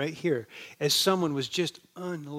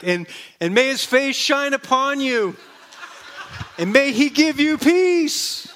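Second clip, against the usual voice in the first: high, loud blessing, slow at the end with a laugh; shouted blessing; 7 semitones higher, 5 dB louder, slower than usual. Retold or imitated. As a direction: call the blessing after a car driving away. Shouting blessing at departing car.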